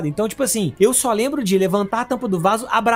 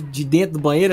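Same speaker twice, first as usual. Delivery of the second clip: usual speaking voice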